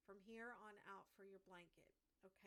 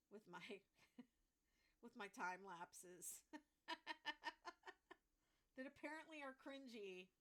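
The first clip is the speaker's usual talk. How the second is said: giggling through the words, real amusement; laughing; 3 semitones higher, 5 dB louder than usual. Real, not acted. Crochet streamer laughing at her cringy time lapses, giggling. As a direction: laugh it out, a bit embarrassed.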